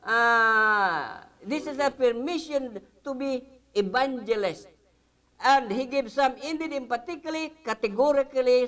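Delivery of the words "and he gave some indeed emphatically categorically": high-pitched "indeed, emphatically, categorically", one after another; emphatic insistence. Shown as a demonstration. Teacher rendering the Greek sense of the verse, emphatic. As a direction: drive home "emphatically, categorically" with raised pitch.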